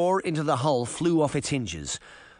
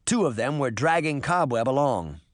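ordinary delivery

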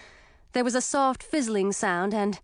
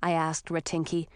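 plain reading voice

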